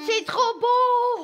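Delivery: excited. Real, not acted. Child thrilled at the treats.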